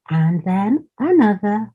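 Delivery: usual speaking voice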